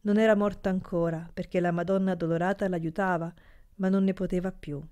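ordinary delivery

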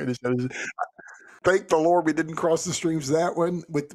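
usual speaking voice

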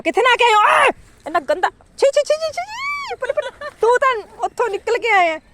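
squealing high disgust. Real, not acted. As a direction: shriek ew at the dirty dog. Disgusted at muddy dog.

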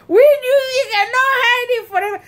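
high, quick teasing jeer; rushed mock taunt, voice way up; mocking children. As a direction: imitate kids crowing in triumph. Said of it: "these children mocked me".

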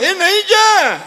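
high-pitched, stretched call; mock alarmed warning. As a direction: cry out as if stopping a toddler. Family warns toddler off the lamp. (ઊંચા સૂરે ગભરાટભરી ચેતવણી)

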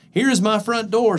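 high, cheerful little mouse. Acted. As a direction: show it off brightly. Mouse speaks.

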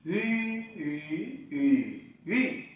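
"Uy" with rounded lips, through four Mandarin tones. Shown as a demonstration. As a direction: round the lips on each "uy", changing tone. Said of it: "chúng ta đọc lại". (đọc "uy" tròn môi, bốn thanh)

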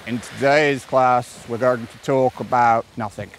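low, slow droning teacher; boring teacher. Shown as a demonstration. Coach imitating a dull schoolteacher addressing class, bored drone. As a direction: drone it out slowly like a dull lecture. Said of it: "the most boring teacher you ever had".

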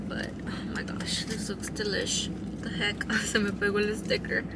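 hushed, mostly unvoiced murmur over food; whispering while eating. Real, not acted. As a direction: murmur softly between bites. Eating salad alone in car.

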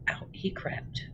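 unvoiced hush, as if not to wake her; hushed narration of creeping out; storyteller whisper. A narrator's reading. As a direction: whisper it like a sneaking secret. Sneaking out while mother sleeps.

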